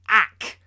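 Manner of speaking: a short hurt squawk; Penguin's yelp. Acted.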